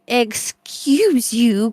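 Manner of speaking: slow, drawn-out mock meow; cat's indignant meow. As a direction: stretch it like an offended cat. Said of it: "kind of meow".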